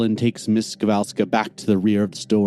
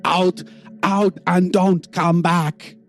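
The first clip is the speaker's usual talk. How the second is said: angry shopkeeper; 11 semitones higher, much slower than usual. Acted. Shopkeeper throws out thief.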